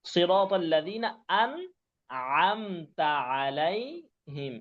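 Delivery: সিরাতাল লাযিনা আনআমতা আলাইহিম drawn out very slowly, আইন sounds clear; slow recitation demo. Shown as a demonstration. Teacher recites Fatiha slowly to show the two আইন. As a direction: recite it very slowly, stressing each আ. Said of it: "এই যে দুইটা আইন".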